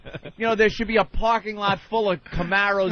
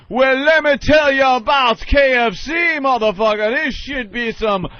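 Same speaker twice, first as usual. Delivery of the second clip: loud mock TV pitchman; 5 semitones higher, 5 dB louder than usual. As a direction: shout it like a cheesy ad.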